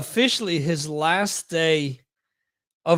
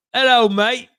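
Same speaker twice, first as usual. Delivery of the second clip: loud, high-pitched mock British greeting; British accent; 6 semitones higher, 7 dB louder than usual. Shown as a demonstration. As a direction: put on a cheeky British accent, pitched up and punched out. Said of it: "working on my British accents".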